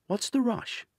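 high, lifted British question; posh British query. A narrator's reading. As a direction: toss it off lightly, British and posh. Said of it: "Kieran asks".

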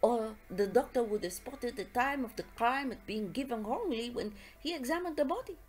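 put-on methodical French-accented detective; Poirot. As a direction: lay out the case point by point in a French accent. Poirot reasons through the case.

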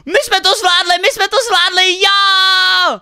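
high, elated shouting; triumphant cheer. Real, not acted. Player celebrates finished training with friends, real triumphant cheer. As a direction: yell the victory out, rising into a long loud whoop.

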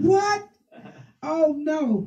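high-pitched, startled recoil; retold shock. Woman retelling her answer to God's news, shocked. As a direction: react in mock alarm, pitch jumping up.

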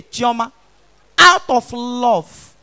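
ordinary talk

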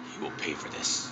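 low, hushed villain whisper; Gandalf. Acted. Villain vowing revenge on those who beat him, hushed menace. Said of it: "And Gandalf's like".

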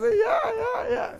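high laughing yell; laughing shout. Retold or imitated.

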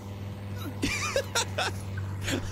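high unvoiced wheezing laugh; breathless laugh. Real, not acted. After being run over.

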